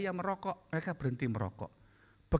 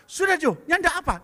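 brushing it off; 9 semitones higher, 8 dB louder than usual. Retold or imitated. Woman brushing it off.

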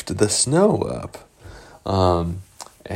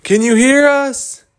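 loud, high holler with a long last word; yelling search call; 6 dB louder than usual. Acted. Searchers yelling to the lost sailors, high shout. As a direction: holler out to someone far away. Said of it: "they were going around there yelling".

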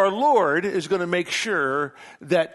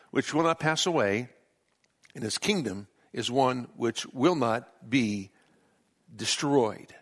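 lower, measured reading of scripture; 4 semitones lower than usual. Acted. Pastor reading Daniel to congregation, solemn scripture reading.

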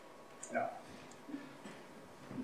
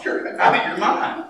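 telling it through a laugh; laughing disbelief. Retold or imitated. Wife teasing her husband, said laughing. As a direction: chuckle as you quote her. Wife calls him crazy.

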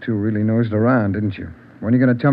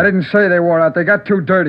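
higher, louder, defensive protest; flustered outburst; 6 semitones higher, 5 dB louder than usual. Acted. Cornered suspect raising his voice at detectives, defensive. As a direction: blurt it out, cornered.

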